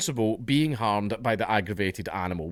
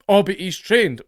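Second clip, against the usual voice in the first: mock bear owner; 8 semitones higher, 5 dB louder than usual. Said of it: "those people that, like, keep bears".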